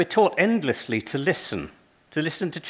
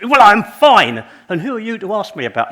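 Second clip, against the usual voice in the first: a defensive outburst, louder with a hurried end; defensive snap; 5 semitones higher, 10 dB louder than usual. Retold or imitated. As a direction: bristle and push back hard. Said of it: "escalating reply".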